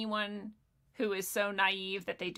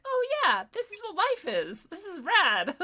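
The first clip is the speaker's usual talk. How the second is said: put-on much higher, bright thrilled kid voice; kid's excited discovery; 10 semitones higher than usual. Voicing kids after Murder Mountain, thrilled and much higher. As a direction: sound like a kid who just found out life is awesome. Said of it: "be like".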